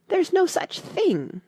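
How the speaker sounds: high, slow, indignant protest; Alice bristling, voice shooting up; angry. A narrator's reading. Alice protesting the treacle well, angrily. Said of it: "Alice was beginning very angrily".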